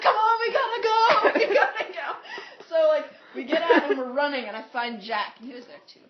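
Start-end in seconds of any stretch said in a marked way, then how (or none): 0.0-2.1 high, loud call through laughter; urgent mock shout. Friends at the door call the dreamer away, mock-urgent shouting. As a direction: yell to hurry them along, half laughing. Friends urge escape from doorway.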